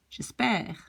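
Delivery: "j'espère" with eh in the last syllable; open è. Shown as a demonstration. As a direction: open the final vowel of "j'espère". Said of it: "then you have an E".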